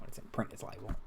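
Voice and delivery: soft breathy whisper. Shown as a demonstration. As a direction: mouth it under your breath. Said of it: "Slander spoken".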